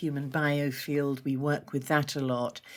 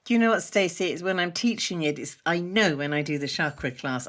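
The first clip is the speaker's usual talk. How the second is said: ordinary talk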